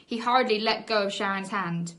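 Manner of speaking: ordinary delivery, nothing marked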